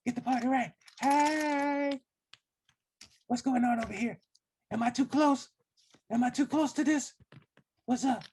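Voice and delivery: very high, shouty puppet voice; squeaky marionette character voice. Acted. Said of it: "He's doing the puppet voice".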